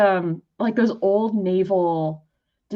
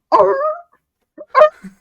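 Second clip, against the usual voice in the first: loud high barking sea lion roar; sea lion impression; 8 dB louder than usual. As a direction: bark it out like a sea lion bull. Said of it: "my world famous sea lion impression".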